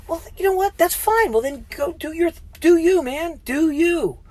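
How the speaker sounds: high, scornful brush-off; high-pitched sarcastic do you, man; dismissive. Acted. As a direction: wave them off mockingly. Brushing off critics, voice jumps high.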